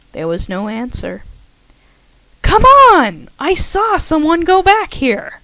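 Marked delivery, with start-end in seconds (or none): 2.4-5.4 much higher, loud calling out; exasperated call. A narrator's reading. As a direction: call out, fed up and insistent. Heroine calls out, frustrated.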